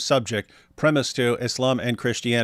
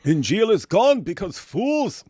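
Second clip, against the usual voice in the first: higher, slower, mocking read of the claim; mocking quote; 7 semitones higher than usual. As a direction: parrot the opponent's words with a sneer. Reading out opponent's claim mockingly.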